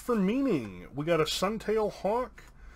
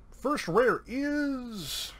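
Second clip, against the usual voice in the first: suspenseful reveal; 7 semitones higher, much slower than usual. Real, not acted. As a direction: draw out IS, pitched high. Suspenseful card reveal to patron.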